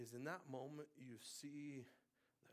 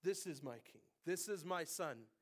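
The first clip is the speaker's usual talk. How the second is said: proclamation building louder and higher; declamatory scripture reading; 7 semitones higher, 11 dB louder than usual. Real, not acted. Preacher proclaims God's decree over his Son, building declamation.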